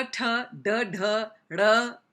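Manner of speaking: t and d sounded out one by one, loud; isolated letter sounds. Shown as a demonstration. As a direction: spit out "t, d" as separate crisp sounds. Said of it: "So there's no t".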